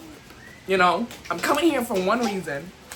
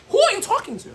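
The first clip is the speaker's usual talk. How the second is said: pitched way up, indignant and half laughing; outraged retort; 11 semitones higher, faster than usual. Retold or imitated. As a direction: throw it back at her in disbelief. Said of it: "I said".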